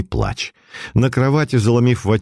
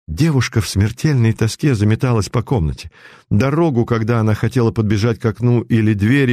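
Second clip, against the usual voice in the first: plain reading voice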